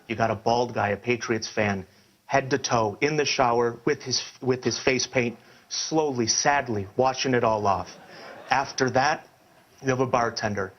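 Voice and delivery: ordinary talk, nothing marked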